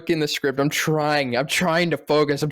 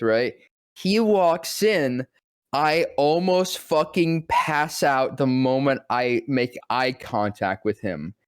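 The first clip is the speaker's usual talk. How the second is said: ordinary talk, nothing marked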